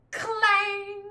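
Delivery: loud ringing clang like a giant bell. Acted. Voicing the golden bell for the listeners, a ringing clang. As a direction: belt out the bell's clang.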